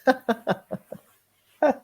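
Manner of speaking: ordinary delivery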